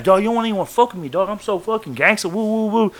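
mock him as a wannabe gangster, fast; mocking a wannabe gangster's fast, high boast; mocking gangster talk. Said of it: "Starts throwing all that blood talk out".

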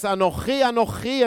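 Anochi, Anochi said in Hebrew with a guttural ch, rising; Hebrew word said twice. Shown as a demonstration. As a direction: repeat Anochi with the Hebrew throat sound. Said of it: "in Hebrew twice".

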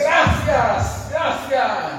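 loud, high cry of thanks to God; shouted thanks. Real, not acted. Preacher thanking God before the congregation, shouting. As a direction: shout your gratitude to the heavens.

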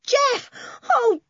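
very high, excited shouting; overjoyed yell. A narrator's reading. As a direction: yell with relief at finding them. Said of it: "yelled Toby".

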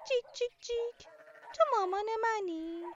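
high, loud chirpy chick voice cheeping its question; little lost chick. Acted. Lost chick asks the cow for its mum, high chirpy cheep. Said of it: "جوجه بهش گفت".

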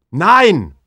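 loud, high shout of refusal. Acted. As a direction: yell no at him. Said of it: "schreie ich".

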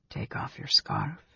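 soft unvoiced whisper. A narrator's reading. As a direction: breathe the words quietly. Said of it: "he whispered".

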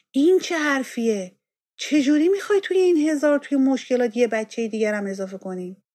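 husband flaring up at the news; upset. Acted. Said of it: "برآشفته گفت". (برآشفته و با صدای بلند)

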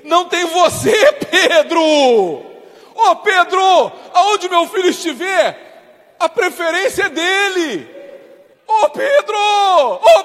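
God's voice, loud and commanding, calling Pedro; preaching at full force. Acted. God rebuking Pedro, preached loud and forceful. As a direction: proclaim it over the crowd, full volume.

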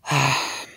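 weary acted sigh. Basil confiding to the count, a sigh. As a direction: let out a sigh before going on.

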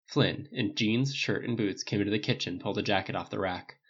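ordinary delivery, nothing marked

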